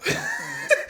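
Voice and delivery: high, breathless burst of laughter. Real, not acted. Mechanic laughs at how he ripped out the bracket, high burst of laughter. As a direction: crack up at yourself. Laughing at his own panic.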